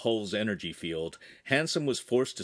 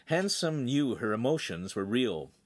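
ordinary delivery

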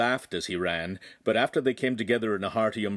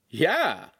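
high, enthusiastic cheer; with gusto; 8 semitones higher, 4 dB louder than usual. A narrator's reading. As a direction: say it with real relish. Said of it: "Lincoln said with gusto".

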